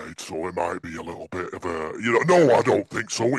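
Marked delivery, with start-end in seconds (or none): none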